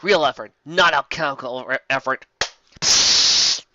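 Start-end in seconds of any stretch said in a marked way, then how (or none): 2.8-3.6 psst. Acted. Whispered aside.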